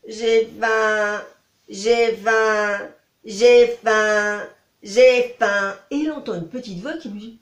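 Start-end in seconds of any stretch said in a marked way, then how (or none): 0.1-5.7 pleurnichant. Acted. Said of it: "il se met à pleurer en disant".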